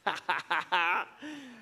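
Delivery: short burst of laughter. Real, not acted. Laughs while baby is brought up.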